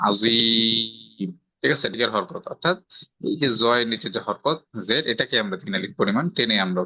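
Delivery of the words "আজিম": আজিম with the জি held three alif long; three-alif stretch. Shown as a demonstration. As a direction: draw out the জি of আজিম slowly and fall. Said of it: "তিন আলিফ পরিমাণ টেনে পড়ব". (আজিম, জি-তে তিন আলিফ টান)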